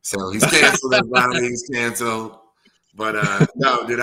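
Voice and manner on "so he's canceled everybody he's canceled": announcing. Real, not acted. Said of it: "officially been canceled".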